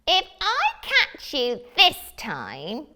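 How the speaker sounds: slow, loud, rising to a sung high wail; menacing wizard threat. Acted. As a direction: draw out the threat, ending in a shrieking sing-song.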